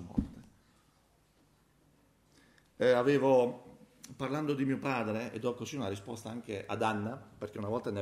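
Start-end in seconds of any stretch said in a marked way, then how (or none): none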